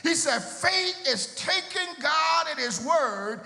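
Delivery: preaching at full force. Acted. Pastor quoting Gardner Taylor to the church, full preaching whoop. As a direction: build the close like a shout to heaven. Preacher builds to sung climax.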